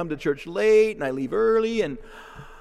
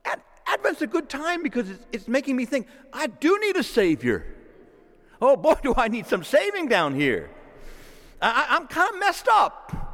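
pitched up, pleading confession to God; model prayer cry; 8 semitones higher than usual. Pretend. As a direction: cry it out like a humbled sinner. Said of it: "cry out to God like that and say".

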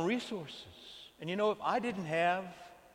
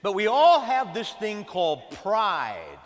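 slow, louder, leaning hard on "all" and "pride"; slow loud emphasis; 4 semitones higher, 7 dB louder, slower than usual. Acted. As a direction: drive the word pride home slowly. Preacher hammers on pride.

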